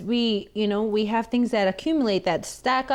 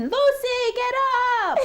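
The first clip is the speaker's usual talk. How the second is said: high mock call to a sleepy child; mom calling her up; 13 semitones higher than usual. Nun imitating mom calling Lucy out of bed, in a hammed-up high shout. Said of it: "And then she comes in".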